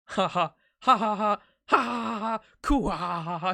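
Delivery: building arrogant royal laughter; swelling Gilgamesh-style cackle; Gil laugh. Acted. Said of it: "he's literally just Gil".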